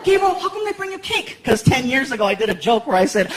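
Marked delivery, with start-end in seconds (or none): 0.0-1.4 put-on little boy. Son Frankie walking into the kitchen, high kid voice. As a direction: play a sleepy excited kid.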